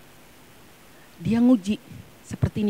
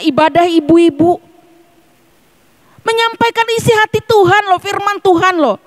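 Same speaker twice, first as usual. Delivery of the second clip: fiery preaching; 12 semitones higher, 7 dB louder than usual. Real, not acted. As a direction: preach it out at full force, stretching the words. Preaching at full force. (khotbah berapi-api, keras dan tinggi)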